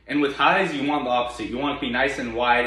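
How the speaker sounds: ordinary talk, nothing marked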